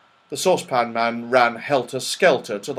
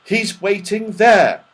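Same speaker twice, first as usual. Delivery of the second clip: raised, slow and high; shouting; 10 semitones higher, 6 dB louder, slower than usual. Acted. Joe telling the saucepan man where to go, raised voice. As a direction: call it out slowly so he hears. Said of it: "shouted Joe".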